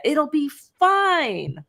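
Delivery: high, drawn-out mock reassurance; breezy dismissal. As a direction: imitate a husband airily waving off worries, stretching it out. Acting out husband brushing off warnings.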